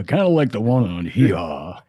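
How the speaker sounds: lightly imitated deadpan comedian's voice, drooping at the end; a hint of someone else's voice, falling away on the last word. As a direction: do a light impression of a dry bandleader. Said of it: "I could hear that voice of his too".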